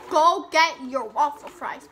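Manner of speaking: loud call to viewers; yelling. Real, not acted. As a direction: shout it out to everyone. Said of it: "I, like, yelled that".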